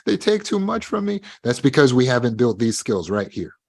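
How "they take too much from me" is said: higher, whiny mock-afraid voice; higher, mock-anxious complaining; mock fearful whine. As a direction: imitate someone scared of people. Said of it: "When we're afraid, like".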